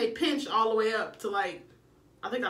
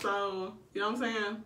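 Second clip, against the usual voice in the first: ordinary talk, nothing marked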